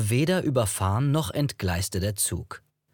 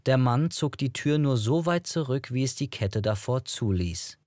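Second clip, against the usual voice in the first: ordinary delivery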